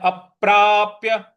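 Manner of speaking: अप्राप्य with both प sounds hit firmly; stress on प. Shown as a demonstration. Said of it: "ऐसे बोलना है".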